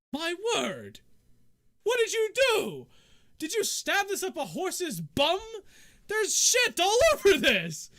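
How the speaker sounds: high, loud, scandalized exclamations; appalled outrage. Acted. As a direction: nobleman aghast, building to a yell. Nobleman finds his rapier soiled.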